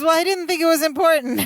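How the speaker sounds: high-pitched mock innocence; innocent excuse. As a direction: play a naive friend excusing himself. Acting out Balky's sheepish excuse.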